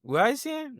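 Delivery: a squeaky imitation of the older nurse; high mock nurse voice. Speaker imitating the nurse echoing his question, high mock voice. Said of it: "then she was like".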